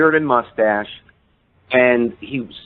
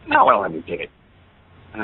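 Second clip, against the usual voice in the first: dismissive; faster than usual. Retold or imitated. Passerby who laughed at the ticket request, dismissively. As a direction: imitate someone brushing off a silly question. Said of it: "he laughed at me".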